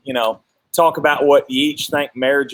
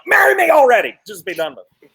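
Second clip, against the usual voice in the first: mock frustrated; 6 semitones higher, 3 dB louder, faster than usual. Imagined frustrated proposer.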